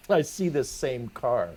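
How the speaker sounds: normal talking voice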